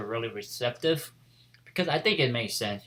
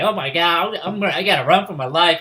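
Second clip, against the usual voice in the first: higher, louder, rushed mock panic; retold panic; 5 semitones higher, 7 dB louder, faster than usual. Boy fleeing as she comes after him, mock panic. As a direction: blurt it like you must flee. Retold character flees the whip.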